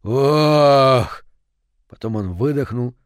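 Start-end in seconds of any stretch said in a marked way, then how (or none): 0.0-1.2 loud, long drawn-out "oh"; shivering groan. A narrator's reading. Father shudders with cold by the fire, long loud groan. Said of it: "зябко задрожал всем телом".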